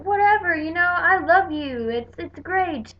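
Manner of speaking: lightly voiced cheery person, then trailing off; mock holiday cheer. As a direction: ham up the fake goodwill. Mocking cheerful holiday spirit.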